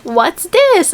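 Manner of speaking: high-pitched mimic of a curious pupil; imitated schoolgirl. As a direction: imitate a little girl asking. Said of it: "one girl points at this boy".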